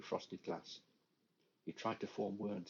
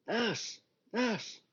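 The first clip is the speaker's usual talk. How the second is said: high, loud calls for the nurse; calling out; 14 semitones higher, 9 dB louder than usual. Acted. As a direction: shout for the nurse to come back. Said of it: "he called out".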